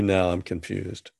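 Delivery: normal talking voice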